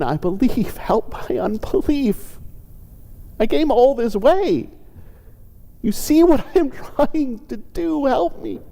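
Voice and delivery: high, pleading voice close to tears; sobbing in desperation. Shown as a demonstration. Father begging Jesus to heal his son, desperate and sobbing. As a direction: beg as if you are about to break down. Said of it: "I can imagine him sobbing, speaking in desperation".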